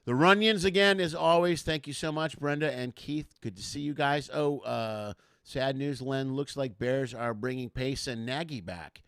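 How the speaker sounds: ordinary talk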